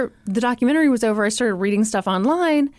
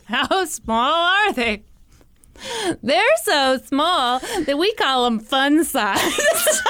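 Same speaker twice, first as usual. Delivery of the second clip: laughing; 4 semitones higher, much slower than usual. Real, not acted. Joking about tiny bombs, laughing.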